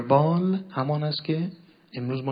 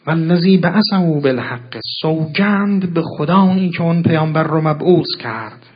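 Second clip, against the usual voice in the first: loud, raised declamation; solemn oath; 4 semitones higher, 9 dB louder than usual. Acted. Preacher proclaiming the Imam's oath to listeners, loud declamation. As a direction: proclaim the oath slowly and forcefully.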